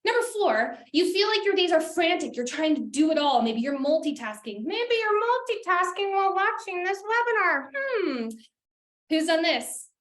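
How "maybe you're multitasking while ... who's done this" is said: higher, sing-song knowing tease; teasing accusation. Real, not acted. Presenter to webinar attendees who may be multitasking, playfully suspicious. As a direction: playfully call them out, ending on a pointed hmm.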